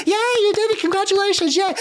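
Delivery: high, loud celebratory squeal; a clown cheering the winners. Acted. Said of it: "puts the hat and was like".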